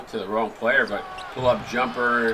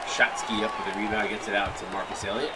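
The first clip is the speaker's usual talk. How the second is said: ordinary delivery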